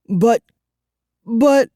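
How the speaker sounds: high, loud panicky stammer; frightened stammer. A narrator's reading. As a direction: stumble over the words in fright. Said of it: "I stammered".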